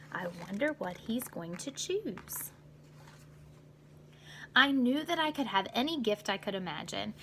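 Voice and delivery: ordinary delivery, nothing marked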